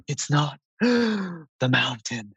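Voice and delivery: imitating breathless gasps between halting words; slow, hammed-up panting, gasp mid-sentence; heavy breathing. Brother struggling to confess on the mountain hike, imitated heavy breathing. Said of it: "he said it like this ... he's breathing so heavy".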